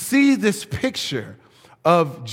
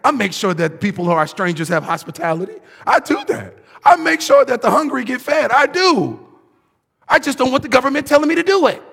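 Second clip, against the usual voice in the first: higher, louder, insistent self-defence; defensive mock voice; 7 semitones higher, 5 dB louder, faster than usual. Mocking a defensive churchgoer, higher and more insistent. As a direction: protest your own goodness, getting hotter. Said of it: "get defensive".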